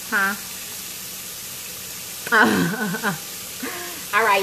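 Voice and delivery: normal talking voice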